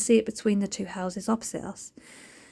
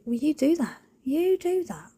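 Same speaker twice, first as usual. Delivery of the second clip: indulgent to cats; 7 semitones higher than usual. Retold or imitated. Talking to the cats.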